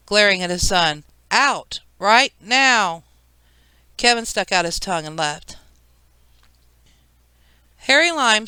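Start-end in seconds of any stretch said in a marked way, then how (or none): 1.3-3.0 firm, punched words of an angry father; shouted order. A narrator's reading. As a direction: order the child out, each word forceful. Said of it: "Peter shouted".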